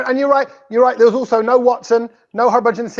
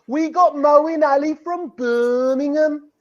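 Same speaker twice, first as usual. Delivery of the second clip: slow, higher mock Brummie model; Birmingham accent; 4 semitones higher, slower than usual. Shown as a demonstration. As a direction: draw it out in an exaggerated Birmingham accent. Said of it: "help me out here with a little bit of".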